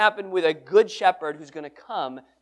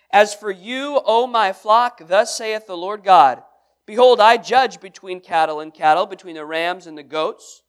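scripture reading; 7 dB louder than usual. Acted. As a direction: read the verses like a proclamation. Reads scripture aloud.

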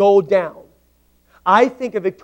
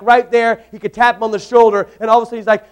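normal talking voice

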